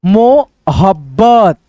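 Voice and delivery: मोहब्बत drawn out very slowly; slow read-aloud. Shown as a demonstration. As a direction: stretch मोहब्बत for the learners. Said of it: "यहाँ पे देखो". (मोहब्बत को बहुत धीरे पढ़कर बताओ)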